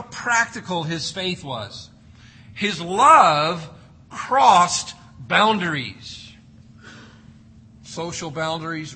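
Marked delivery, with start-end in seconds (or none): 2.5-6.3 punched out loud and slow; emphatic. Real, not acted. Preacher driving home the point, loud. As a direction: stress love and crossed.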